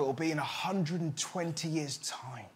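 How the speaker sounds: soft whisper of wonder; dreamy hushed whisper; whispered dream. Acted. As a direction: breathe it like a secret. Dreamy future vision.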